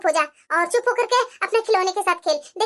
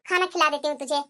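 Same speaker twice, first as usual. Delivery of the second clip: ordinary talk, nothing marked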